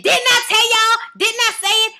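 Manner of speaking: high, loud I-told-you-so; triumphant. Real, not acted. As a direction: crow it out, proud to be right. Gloating over a correct prediction.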